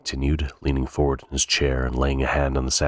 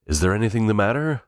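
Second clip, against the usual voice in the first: higher, raised call through the door; 8 semitones higher, faster than usual. A narrator's reading. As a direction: call out with concern. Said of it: "Helmholtz called".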